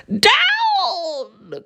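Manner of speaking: very high laughing witch cry; gleeful high command; witch cries down. A narrator's reading. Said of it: "cried the witch".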